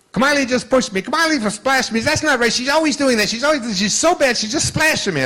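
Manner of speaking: put-on high, loud whiny little girl complaining; whiny tattling girl. Grandpa retelling his granddaughter's whining, high and loud. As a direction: whine it out like a tattling child.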